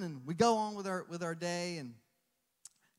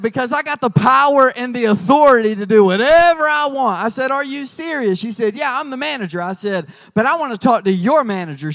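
louder, bold, proclaiming; triumphant declaration; 5 semitones higher, 15 dB louder than usual. Retold or imitated. Brenda the manager proclaiming it fixed, triumphant and loud. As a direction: declare it done with authority.

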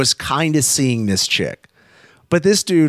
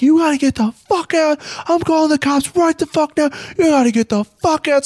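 imitated panicked yelling; 13 semitones higher than usual. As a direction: shout them out, threatening to call the cops. Friend orders them out, threatens cops.

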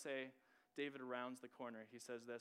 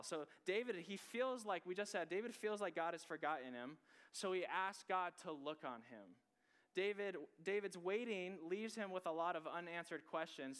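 preaching; 5 semitones higher, 4 dB louder than usual. Acted. Preaching at rising force.

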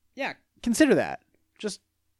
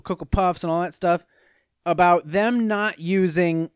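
usual speaking voice

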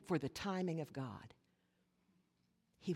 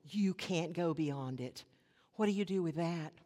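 ordinary delivery

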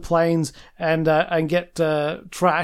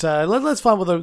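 ordinary talk

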